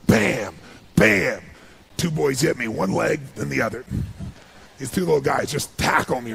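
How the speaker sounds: loud burst of excitement as they tackle him; excited. Real, not acted. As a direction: punch it out, thrilled at the memory. Tackled by two boys.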